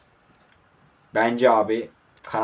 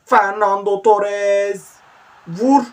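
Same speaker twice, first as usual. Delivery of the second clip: drawn-out, much higher and louder name call; commentator goal call; 8 semitones higher, 7 dB louder, much slower than usual. Real, not acted. Calling the striker's name as he shoots, slow and soaring. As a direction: stretch the name like a stadium announcer.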